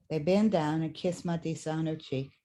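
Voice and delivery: normal talking voice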